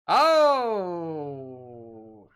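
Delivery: a loud startled oh; surprised. Real, not acted. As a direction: caught off guard by the news. Reacting to the talking doll.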